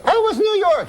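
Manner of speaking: high falsetto wife; high woman's voice. Retold or imitated. Wife greeting husband home, high falsetto. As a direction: ask it in the wife's high voice. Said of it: "She's a woman, high voice".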